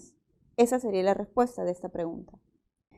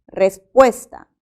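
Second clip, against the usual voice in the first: Respuesta slowed and louder, its stressed syllable marked; 9 dB louder, slower than usual. Shown as a demonstration. Teacher to students, slowly marking the stress in Respuesta. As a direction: drag out Respuesta to show where the stress falls. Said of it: "también es grave".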